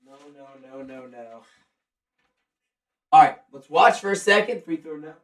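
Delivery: ordinary talk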